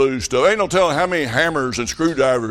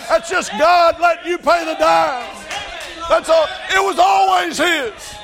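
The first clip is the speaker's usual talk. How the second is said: full-force preaching; 14 semitones higher than usual. Acted. Preacher tells the church God lets them pay, in full cry. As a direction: preach it out to the back pew.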